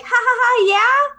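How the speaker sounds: imitated high little-boy answer; giggling child. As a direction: answer like a giggly kid, rising at the end. Child answers when called.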